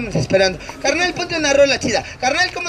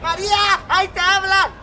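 calling out; 7 semitones higher than usual. Shown as a demonstration. Clown shows a volunteer the cue, calling out high. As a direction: demonstrate hollering for someone, pitched well up. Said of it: "Me va a gritar".

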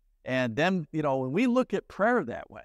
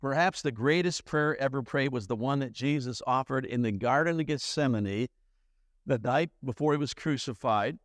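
usual speaking voice

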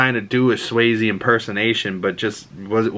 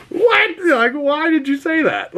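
baffled outburst. Real, not acted. To viewers, reacting to the villain's prison line, stunned and high. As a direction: shoot up in pitch, can't believe it.

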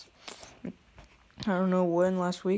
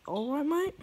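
put-on a playful try at a British accent, pitched higher; kid's higher-pitched mock British accent greeting; 8 semitones higher than usual. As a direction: greet them like a chirpy Londoner, British accent. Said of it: "I'm going to be speaking in a British accent".

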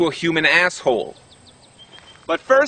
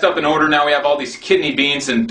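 rushed x1.5 confession; fast aside; faster than usual. Acted. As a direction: blurt it out quickly.

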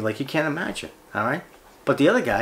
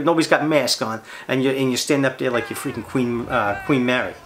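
normal talking voice

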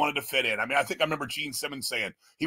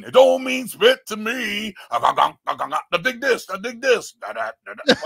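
mocking the bassist's voice, pitched up and loud; 5 semitones higher, 4 dB louder than usual. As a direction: ham up a rock star's swagger. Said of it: "I mean, [name]".

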